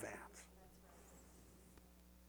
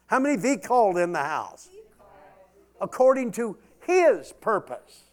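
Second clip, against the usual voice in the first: much higher and louder, shouted sermon; full-force preacher calling out the scripture; preaching at full force; 10 semitones higher, 12 dB louder than usual. Real, not acted. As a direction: lift the house with it.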